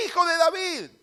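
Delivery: high, loud public cry; a los gritos. Acted. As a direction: shout it out among the crowd. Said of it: "A los gritos, públicamente". (grito público, agudo y fuerte)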